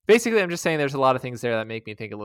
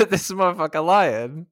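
high laughing, louder; laughing accusation; 6 semitones higher, 3 dB louder than usual. Real, not acted. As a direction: laugh while calling out a liar. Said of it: "you just pulled your shirt".

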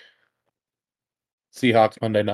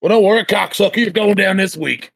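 loud, higher taunting jab; trash talk; 8 semitones higher, 5 dB louder than usual. Real, not acted. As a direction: taunt him with a cocky shout. Trash talk before a matchup.

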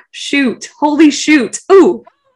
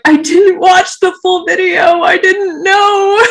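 panicked protest; 9 semitones higher than usual. Retold or imitated. Retelling pleading with Will after sharing a fake video, high wailing protest. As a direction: plead innocence in a rising half-cry. Panicked self-defence to boyfriend.

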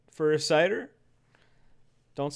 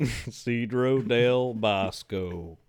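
slow, stretched southern "Cedro del Bosco"; mock southern accent, long slow vowels; much slower than usual. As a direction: say the Italian name in a southern accent. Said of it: "Why in a southern accent now?".

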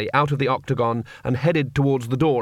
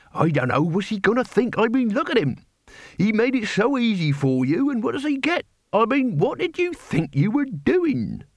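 scolding mentor; 8 semitones higher than usual. A narrator's reading. Boggis scolds his apprentice.

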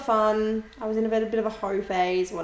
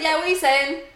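mock roommate; 6 semitones higher, 5 dB louder than usual. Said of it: "He's like".

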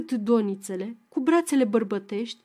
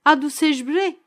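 calling out; 4 semitones higher, 5 dB louder than usual. A narrator's reading. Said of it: "strigă la un băiețel".

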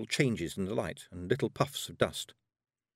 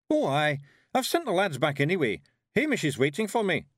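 high, broad Scottish brogue of a tiny warrior; Scottish pixie voice; 6 semitones higher, 4 dB louder than usual. Acted. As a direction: play the wee blue Pictsie, bold and cheeky. Rob replies to Tiffany.

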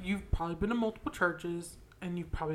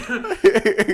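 loud laugh; 4 semitones higher, 18 dB louder than usual. Real, not acted. Laughing at petty God joke.

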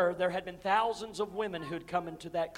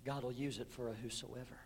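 quiet ministering; 2 semitones lower, 10 dB quieter than usual. Acted. Preacher softly reassuring hurting members, a hushed comfort. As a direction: comfort them one by one, hushed.

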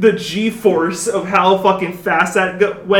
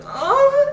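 mock scream. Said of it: "I didn't go like".

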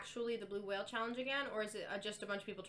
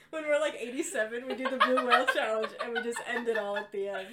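loud, laughing through the words; giggling at own dark joke; 9 dB louder than usual. Real, not acted. Joking while laughing.

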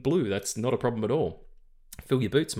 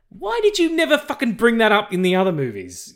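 high, loud exasperated rant; loud, pitched-up complaint that falls away at the end; exasperated outburst; 10 semitones higher, 7 dB louder than usual. Real, not acted. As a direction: burst out in fan frustration. Exasperated complaint about the films' continuity.

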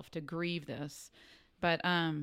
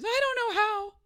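put-on high, louder helpless teen reply; helpless son; 7 dB louder than usual. Son admitting he can't call the firemen, high helpless blurt. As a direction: blurt it out, lost and flustered. Son answers panicked mother.

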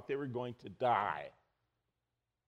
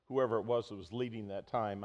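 usual speaking voice

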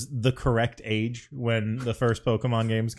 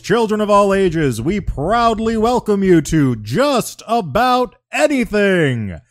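booming ringmaster announcer, higher, louder, slow; radio voice; 6 semitones higher, 8 dB louder, slower than usual. Pretend. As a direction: announce the big show like a ringmaster. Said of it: "I'm good at radio voices".

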